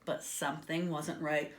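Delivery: usual speaking voice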